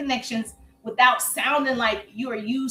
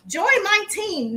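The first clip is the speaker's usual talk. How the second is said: high, slow, drawn-out "Join my team." mocking a pushy recruiter; mocking pushy pitch; 7 semitones higher, slower than usual. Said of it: "I'm just looking at people say".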